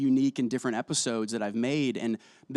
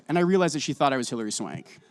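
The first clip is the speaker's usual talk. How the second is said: ordinary talk, nothing marked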